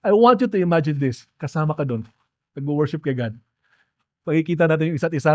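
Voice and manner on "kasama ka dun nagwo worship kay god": ordinary talk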